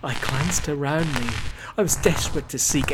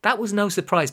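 plain reading voice

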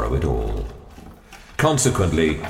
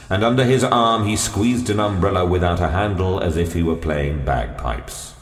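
ordinary delivery

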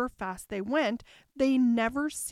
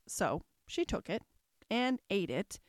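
usual speaking voice

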